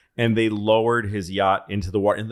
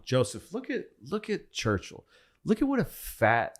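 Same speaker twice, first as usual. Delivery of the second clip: mocking aside; 7 semitones higher, 5 dB quieter than usual. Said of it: "he says to Stalin".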